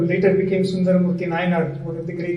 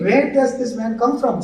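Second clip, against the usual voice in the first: ordinary talk, nothing marked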